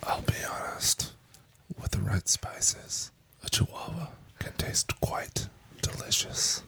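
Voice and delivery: unvoiced, breathy-soft whisper with long pauses; sultry microphone whisper with dramatic gaps. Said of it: "Dramatic pause and everything".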